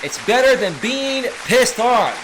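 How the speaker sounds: high, loud punchline delivery; gleeful repeat. Retold or imitated. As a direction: proclaim the joke to the room. Repeats the viewer's joke with relish.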